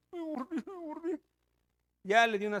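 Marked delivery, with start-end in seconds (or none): 0.1-1.2 high, soft, hurt tongue-tied voice; bitten-tongue speech. Retold or imitated. Woman telling the pastor she bit herself, bitten-tongue speech. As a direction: talk as if the tongue is injured.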